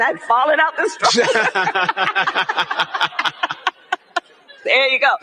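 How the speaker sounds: usual speaking voice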